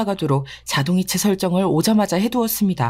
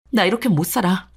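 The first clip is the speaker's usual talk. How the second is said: ordinary delivery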